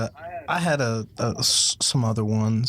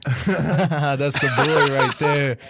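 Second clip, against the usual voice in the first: slow words through laughter; laughing; 3 semitones higher, 4 dB louder, much slower than usual. Real, not acted. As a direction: laugh it out slowly. Laughing after [name] imitation.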